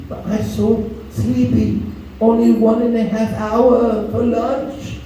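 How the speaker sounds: slow drowsy whine; sleepy teller. Retold or imitated. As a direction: drag it out like you're nodding off.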